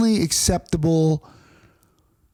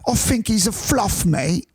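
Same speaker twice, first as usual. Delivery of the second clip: high-pitched mock London lad; comic London-accented verdict, pitched up; 8 semitones higher, slower than usual. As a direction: overdo a poor Londoner's accent. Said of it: "That is a dumb accent".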